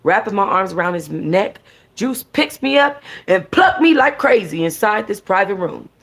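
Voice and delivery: plain reading voice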